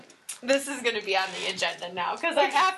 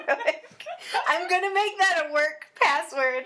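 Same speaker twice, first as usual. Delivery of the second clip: high giggly laughing voice; 7 semitones higher, 4 dB louder than usual. Real, not acted. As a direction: laugh through the joke. Joking, laughing.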